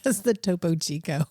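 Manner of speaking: ordinary delivery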